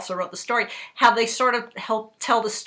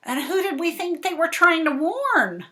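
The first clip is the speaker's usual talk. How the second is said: plain reading voice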